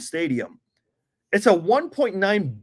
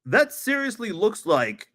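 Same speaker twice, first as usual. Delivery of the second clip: exasperated rant; 4 semitones higher than usual. Real, not acted. Ranting at the NFL.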